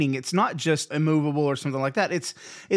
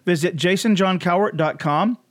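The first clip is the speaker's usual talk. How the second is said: louder announcer-style sign-off; podcast outro read; 3 semitones higher, 5 dB louder than usual. Acted. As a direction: wrap up the show like an ad read, louder.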